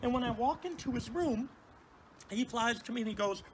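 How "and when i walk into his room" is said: usual speaking voice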